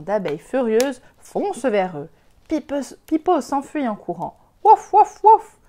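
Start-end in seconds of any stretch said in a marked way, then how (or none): 4.6-5.6 wouf aboyé très haut et très fort; aboiements de chien. Acted. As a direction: aboie comme un petit chien paniqué. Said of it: "en langage de chien". (le chien Pipo qui aboie en fuyant les abeilles)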